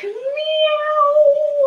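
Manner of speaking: high, stretched-out kitty meow; playful high cat meow. Shown as a demonstration. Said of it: "and we can say meow".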